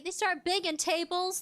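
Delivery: ordinary delivery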